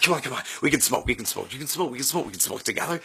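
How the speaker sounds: fast eager urging; hyper stoned pleading. Retold or imitated. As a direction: rush it like a giddy kid. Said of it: "I'm like".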